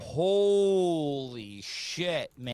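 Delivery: slow, drawn-out disbelief; slow, loud and awestruck; stunned. Real, not acted. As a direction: let it sink in slowly, stunned. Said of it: "Wow, he's really blown away".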